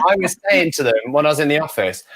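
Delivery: ordinary talk, nothing marked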